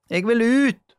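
high, loud cry to get out; shouting. A narrator's reading. Julie shouting from the dark closet, loud. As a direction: yell it, wanting out. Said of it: "ropte Julie".

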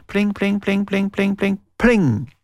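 final plinks, slowing; mock guitar plinks. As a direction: strum the ending out with your voice. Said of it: "han klimpra vakkert på gitaren".

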